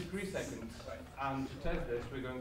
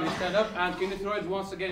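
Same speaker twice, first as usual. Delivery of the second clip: louder call; 4 semitones higher, 7 dB louder than usual. Real, not acted. Request repeated to audience.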